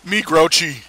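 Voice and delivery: grunting caveman talk; caveman grouch voice. Pretend. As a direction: play a grumpy caveman. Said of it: "I gripe".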